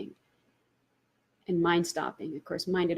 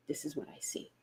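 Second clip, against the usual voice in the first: whispered; 6 semitones lower, 14 dB quieter than usual. Real, not acted. Hushed confession of insight.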